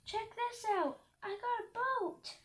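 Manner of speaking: high, eager calling out; excited shouting. A narrator's reading. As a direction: show off the boat to the animals. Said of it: "I shouted to the grazing animals".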